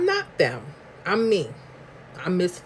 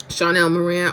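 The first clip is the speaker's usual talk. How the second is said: normal talking voice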